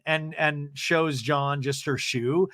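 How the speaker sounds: ordinary talk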